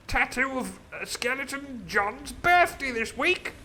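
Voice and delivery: high, loud pompous gentleman voice; posh squire. Retold or imitated. Squire Trelawney praising the cook, high and pompous. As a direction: play a silly grand squire. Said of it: "He's like".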